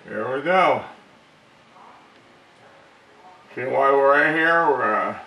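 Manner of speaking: talking around a flashlight held in teeth; flashlight in mouth. Real, not acted. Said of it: "let me take this out of my mouth".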